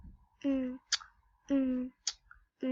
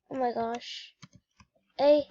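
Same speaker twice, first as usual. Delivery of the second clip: usual speaking voice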